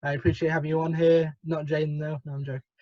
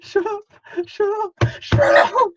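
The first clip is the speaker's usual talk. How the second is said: builds higher and louder into a yell; escalating, each one louder; rising shout; 13 semitones higher, 6 dB louder than usual. Real, not acted. Teased, repeats shut up.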